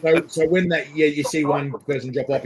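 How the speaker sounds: usual speaking voice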